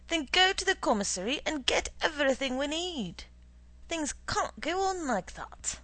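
indignant official lecturing an underling; pompous, scolding manager; blustering boss. Acted. Boss character giving orders.

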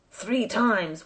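plain reading voice

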